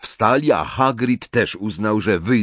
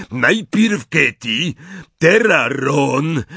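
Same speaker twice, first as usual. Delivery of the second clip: slow, louder giant voice rising to a shout; upset Hagrid; 7 semitones higher, 5 dB louder, much slower than usual. A narrator's reading. Hagrid listing the victims, rising to an upset shout. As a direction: burst out with the second name.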